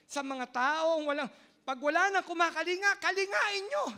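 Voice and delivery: loud, high preaching cry; impassioned preaching. Acted. Pastor crying out to the congregation, impassioned. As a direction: proclaim it to the whole congregation.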